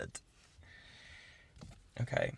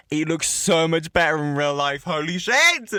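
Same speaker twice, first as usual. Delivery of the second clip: high, loud, bursting with delight; thrilled exclamation; 6 semitones higher, 8 dB louder than usual. Real, not acted. Seeing the phone in real life, a loud thrilled exclamation. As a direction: let the excitement explode out.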